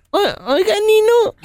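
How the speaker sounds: put-on high-pitched little boy voice; high, hesitant childish call; little godson. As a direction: play a small child calling godfather. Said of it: "Pues le, le dice".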